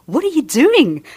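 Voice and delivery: high, loud, slow self-reproach; shocked at himself. Retold or imitated. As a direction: snap out of it, baffled at yourself. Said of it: "And then I thought".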